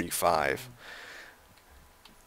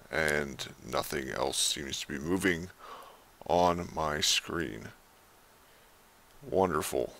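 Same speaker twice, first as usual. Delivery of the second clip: explorer's voice. Acted. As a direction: read it like a lone explorer's journal entry. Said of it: "explorer's voice".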